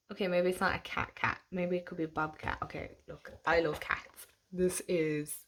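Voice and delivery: ordinary delivery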